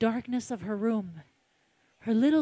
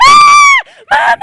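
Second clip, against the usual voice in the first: put-on piercing high scream of a frightened child; high terrified child's scream; 22 dB louder than usual. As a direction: shriek for mommy out of nowhere.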